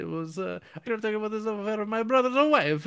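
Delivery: high, loud, hammed-up Italian accent. Benigni the cabbie rambling to the dying priest, loud hammy Italian accent. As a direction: ham up a babbling Italian cabbie. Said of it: "right when you started the Italian accent".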